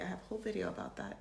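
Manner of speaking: quick throwaway plug; rushed low aside. Real, not acted. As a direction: mutter the plug quickly under breath.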